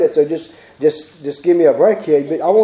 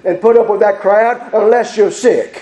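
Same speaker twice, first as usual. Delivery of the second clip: high, raised preacher's voice; full-force preaching; 7 semitones higher than usual. Acted. Preacher urging church to help the needy, full-force preaching. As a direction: preach it out, pitched high and urgent.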